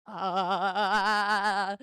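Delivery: long, loud, wavering "aaah"; drawn-out "ahh". Real, not acted. Streamer filling a gap on stream, drawn-out loud "ahh". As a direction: hold one loud, vibrating vowel.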